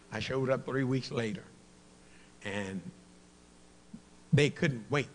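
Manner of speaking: ordinary talk, nothing marked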